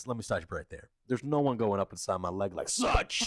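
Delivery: low, firm mock yell cutting him off; stern retold shout. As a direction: put your foot down, half-joking. Retelling telling off a security guard.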